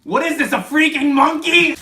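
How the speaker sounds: high, loud furious outburst; angry shout. Real, not acted. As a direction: yell at the toy in disgust. Angry at unwanted toy.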